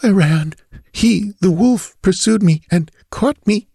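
put-on high, broken voice of a dying girl; shuddering, dying away. A narrator's reading. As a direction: gasp it out in fearful pieces. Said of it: "words died away in shuddering silence".

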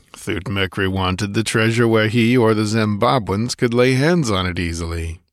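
usual speaking voice

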